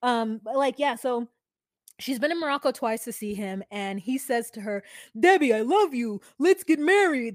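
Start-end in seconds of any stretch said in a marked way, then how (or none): 5.2-7.3 loud, declarative mock proposal; imitated ardent suitor. Quoting the boyfriend to the mother, imitated ardent proposal. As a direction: proclaim it like a retold love scene. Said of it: "That's how she said it".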